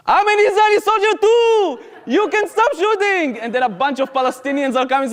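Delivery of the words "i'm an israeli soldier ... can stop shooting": mocking shout. Anarchist echoing the frightened soldier's plea, mocking high shout. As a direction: throw his plea back at him, shouting. Anarchist mocks his cry.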